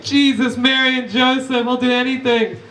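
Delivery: high, pleading cry to heaven; hammed-up begging in prayer; desperate mock prayer. Kids praying when the Wi-Fi went out, high mock-desperate plea. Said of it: "they started praying".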